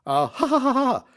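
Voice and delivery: high, loud forced "ha ha ha"; acted uneasy laugh, climbing high; nervous laugh. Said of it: "said Bobby nervously".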